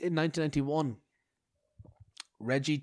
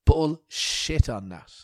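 calling bullshit; 8 dB louder, slower than usual. Real, not acted. Disputing an ancient death date.

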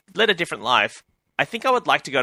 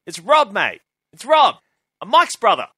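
higher, punched exclamations; mock-exasperated, raised voice; imitated insistent introduction; 8 semitones higher, 5 dB louder than usual. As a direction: insist on being recognised by an old acquaintance. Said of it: "And he goes".

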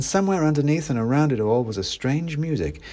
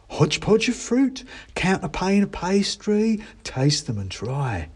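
high squeaky hawker voice; mouse seller; 9 semitones higher than usual. Acted. A mouse offers the narrator a pie, high and eager. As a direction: pitch it up like a cheery mouse. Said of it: "said the mouse".

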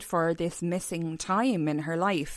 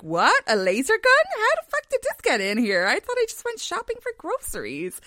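rising higher, louder disbelief; mock shock; 4 dB louder than usual. Housewife spy finding gadgets, climbing into loud high disbelief. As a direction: act baffled at a laser gun in the handbag. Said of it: "be like".

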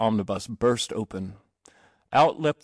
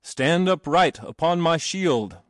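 slow, higher-pitched heroic command; trumpeted cry; 6 semitones higher, 3 dB louder, slower than usual. A narrator's reading. Achilles ordering the boy upright, trumpeted. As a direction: proclaim it slowly, a touch trumpet-like. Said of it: "Achilles trumpeted and cried".

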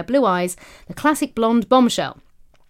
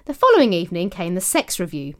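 usual speaking voice

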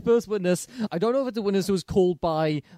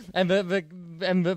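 usual speaking voice

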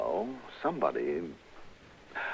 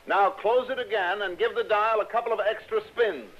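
higher and louder, calling through doors; calling instructions; 13 semitones higher, 3 dB louder than usual. Acted. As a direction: call the order into the next room. Instructs her through closed doors.

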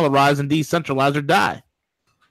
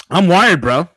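too much caffeine; 4 semitones higher, 5 dB louder than usual. Real, not acted. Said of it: "You sound very caffeinated".

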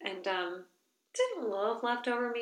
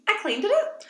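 put-on high, loud kid announcement; loud high child boast; proud child; 12 semitones higher, 8 dB louder than usual. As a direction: proudly declare like a toddler. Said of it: "they're like".

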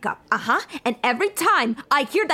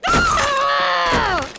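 enraged scream; 12 semitones higher than usual. Acted. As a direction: scream at the dead line. Call fails again.

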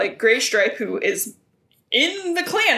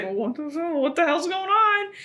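mock baffled clan; 7 semitones higher than usual. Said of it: "everyone's like".

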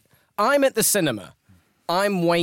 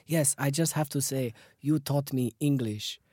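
careful put-on Italian accent, slowing at the end; amateur Italian impression, deliberate wording; 5 dB quieter than usual. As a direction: play an earnest Italian learner of English. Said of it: "attempt an Italian accent now".

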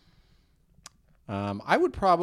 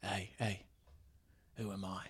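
hushed British impression; quiet British star trying to amuse; Jason Statham; 4 semitones lower, 12 dB quieter than usual. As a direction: ask very softly, lower, close to the mic. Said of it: "whisper it a little bit".

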